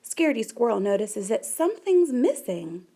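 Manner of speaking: usual speaking voice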